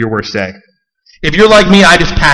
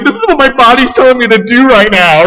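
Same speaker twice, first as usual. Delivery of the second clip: put-on high, loud, childish protest; whiny self-defence; 10 semitones higher, 5 dB louder than usual. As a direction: act like a sulking kid. Defends hiding under blankets.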